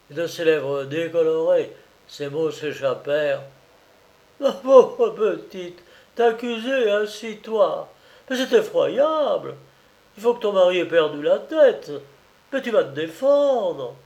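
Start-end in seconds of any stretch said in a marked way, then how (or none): 4.4-13.9 higher and louder, horrified; outraged anguish for her child; anguished mother. Acted. Mother horrified by the letter, higher and louder anguish. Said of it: "manifestait une angoisse indicible".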